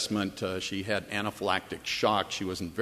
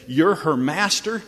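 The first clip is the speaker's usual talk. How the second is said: higher, louder indignant demand; indignant question; 6 semitones higher, 5 dB louder than usual. Retold or imitated. Doctor confronting the pastor, loud and indignant. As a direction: challenge him, offended and loud. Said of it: "with indignation in his voice".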